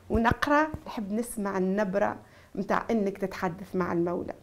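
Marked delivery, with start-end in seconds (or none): none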